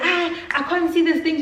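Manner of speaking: high, whiny mock excuse; put-on high-pitched protest; mimicked friend. Friend's excuse voiced in quarrel.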